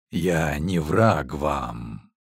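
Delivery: slow, low, solemn denial. A narrator's reading. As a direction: speak slowly, low and grave. Priest's reply in dream.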